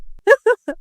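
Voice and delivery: high mocking laugh; short acted laugh of scorn; scornful laugh. (ضحكة سخرية)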